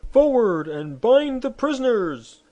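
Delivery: high, loud, slow officers' shout; shouted order. Acted. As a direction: bellow the command. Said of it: "the generals now shouted".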